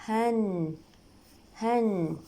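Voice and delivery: هن as "han" with a held n; tanween fatha. Shown as a demonstration. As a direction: say هن as "han", ringing on the n. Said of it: "مع تنوين الفتحة". (تنوين الفتح)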